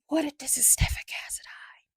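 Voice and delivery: hushed, airy whisper; conspiratorial whisper. Real, not acted. Recapper floats a Stefan Cassadine theory to viewers, conspiratorial whisper.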